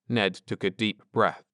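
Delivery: ordinary delivery, nothing marked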